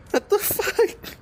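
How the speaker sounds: very high, slow, drawn-out what the fuck; squeaky, slow disbelief; stunned disbelief. Real, not acted. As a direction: stretch it out in shock. Reacting to stuck fish.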